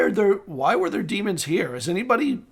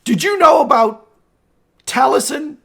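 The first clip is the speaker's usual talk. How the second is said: raised, higher demanding question; angry confrontation; 7 semitones higher, 5 dB louder than usual. Acted. Lord confronting someone about Taliesin, angry and demanding. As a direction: confront them with a sharp demand.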